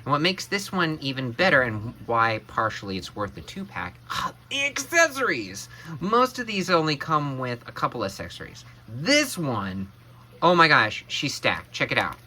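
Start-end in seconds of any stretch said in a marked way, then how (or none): 4.1-5.7 high, gasping delight; excited gasp. Real, not acted. Gasps at the accessories. 10.4-11.1 loud, delighted exclamation; excited. Real, not acted. Reacting to the stacked accessories.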